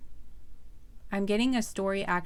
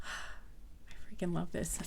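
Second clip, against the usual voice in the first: whispered; 10 dB quieter, slower than usual. Real, not acted. Breathless aside after excited outburst.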